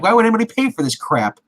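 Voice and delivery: high-pitched outraged customer question; retold freak-out. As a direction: imitate an indignant customer, pitch shooting up. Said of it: "He's like, you know, freaking out".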